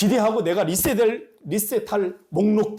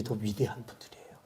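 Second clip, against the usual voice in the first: whisper; 9 semitones lower, 12 dB quieter than usual. Real, not acted. Hushed emphasis on humble pastors.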